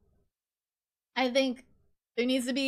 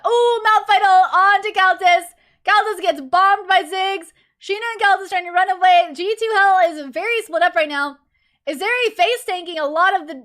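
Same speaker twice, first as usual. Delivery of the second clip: excited play-by-play; 6 semitones higher, 8 dB louder than usual. Real, not acted. Excited casting of team fight.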